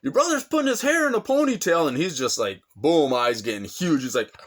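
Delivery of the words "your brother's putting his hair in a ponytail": high, urgent woman's voice; Tammy warns Chad about his brother, high and urgent; alarmed woman. Retold or imitated. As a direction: imitate Tammy's worried warning. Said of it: "Tammy's like".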